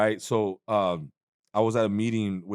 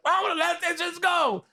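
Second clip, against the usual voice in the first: high mocking voice of his friend. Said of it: "And then he was like".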